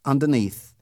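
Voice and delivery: ordinary delivery, nothing marked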